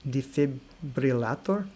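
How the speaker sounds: slow Defibrillator in a Russian accent, rolled R; Russian pronunciation. Shown as a demonstration. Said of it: "In Russian, I'm gonna try defibrillator".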